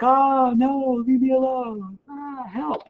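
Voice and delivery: high, pleading cry for help; mock panic. Jefferson pleading as his pockets are picked, high mock panic. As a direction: ham up a helpless victim. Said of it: "poor Jefferson's like".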